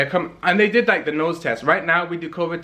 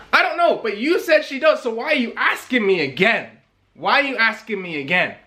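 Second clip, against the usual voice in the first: exasperated retort; 6 semitones higher than usual. Retold or imitated. Exasperated retort to phone caller.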